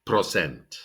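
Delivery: "percent" said as "pro-CENT"; mispronounced percent. Shown as a demonstration. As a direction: swap "per" for "pro" in "percent". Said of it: "a lot of people say".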